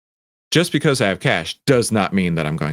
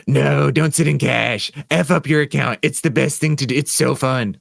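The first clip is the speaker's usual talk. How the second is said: derpy joker; 5 semitones higher than usual. Pretend. Joker voice contradicting the advice.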